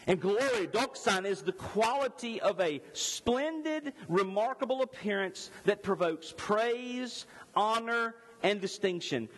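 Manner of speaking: high, driving preacher voice; full-force preaching. Acted. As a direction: preach it up to the rafters, building. Preaching the apostles' witness.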